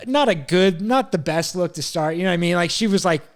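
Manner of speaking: usual speaking voice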